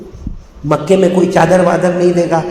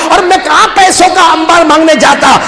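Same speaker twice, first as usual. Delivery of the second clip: put-on crying child; 8 semitones higher than usual. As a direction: beg through tears. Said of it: "آٹھ سال کا بیٹا روتا رہا". (روتے بچے کی التجا)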